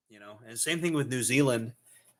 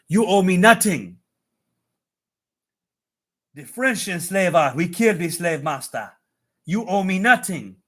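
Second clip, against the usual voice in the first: loud, higher-pitched mock Haitian accent; 5 semitones higher, 6 dB louder than usual. Streamer quoting the Haitians he knows, loud Haitian accent. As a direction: belt it out in a put-on Haitian accent. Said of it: "All the Haitians I know".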